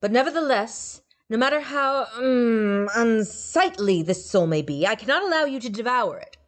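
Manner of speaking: grand god voice; insistent god. A narrator's reading. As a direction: hold the line like a proud god.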